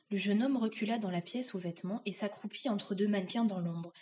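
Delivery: ordinary delivery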